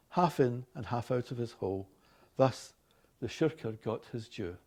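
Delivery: reading a fable aloud; story narration. A narrator's reading. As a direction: tell the tale like a storybook.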